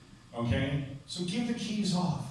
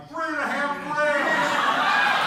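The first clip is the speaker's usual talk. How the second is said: higher, louder and slow, called out proudly; shouted answer; 9 semitones higher, 8 dB louder, much slower than usual. Retold or imitated. Paramedic calling out to the cops, a slow proud shout. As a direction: yell it from the ambulance to the cops.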